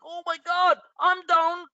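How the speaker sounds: high, mocking whine of a panicky trader; mock the weak complainer; whiny investor. Host mocking a panicky investor, high whiny mock voice.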